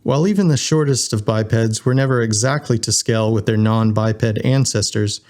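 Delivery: ordinary delivery, nothing marked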